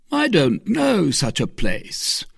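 slow, high, pondering voice; puzzled. Acted. Porthos not knowing the town, slow and puzzled. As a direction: ponder it, at a loss.

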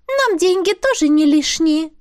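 high, squeaky little spirit, businesslike. Acted. Spirit talking business with the heroine, high squeaky voice. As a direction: pipe it up like a small sprite.

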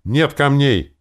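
loud, high, angry bark; abrupt, forceful outburst; barked shout. A narrator's reading. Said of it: "гаркнул Таварин".

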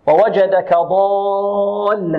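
ووجدك ضالا slow, the lam doubled and held; slow tajwid recitation. Shown as a demonstration. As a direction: recite ضالا with a long necessary madd. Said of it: "هنا مد ماذا". (ترتيل ضالا بمد لازم ست حركات)

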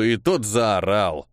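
ordinary delivery, nothing marked

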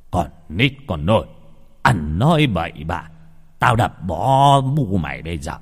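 put-on angry old man's rebuke; gruff scolding. Grandfather threatening the little boy, firm angry rebuke. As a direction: scold the child firmly without raising the pitch. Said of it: "Ông hai quát".

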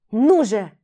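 urgent loud call. Acted. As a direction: call him up, come on. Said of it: "позвала его Джим".

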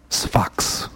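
a dramatic whisper of the place name; hushed, voiceless title card. Acted. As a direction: breathe the word out like a secret title.

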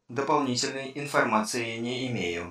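even machine voice, unhurried; old speech synthesizer. A narrator's reading. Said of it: "Комп отрезал". (синтезированный голос компьютера)

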